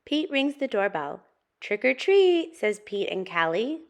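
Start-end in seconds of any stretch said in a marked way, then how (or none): 1.6-2.5 high, loud doorstep call; trick-or-treat call. Acted. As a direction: call it out like an excited kid. Children call out at the door.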